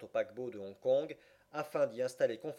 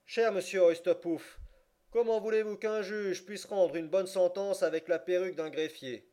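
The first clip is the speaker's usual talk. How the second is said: remonstrate from the bench, high and loud; pompous judge; 6 semitones higher, 5 dB louder than usual. A narrator's reading. (voix de juge, haute et sonore)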